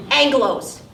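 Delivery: plain reading voice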